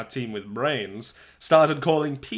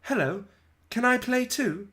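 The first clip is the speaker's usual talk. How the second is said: put-on girl asking to join; 10 semitones higher than usual. A narrator's reading. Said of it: "and said".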